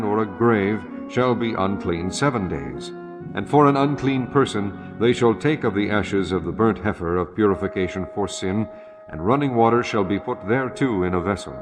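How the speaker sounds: usual speaking voice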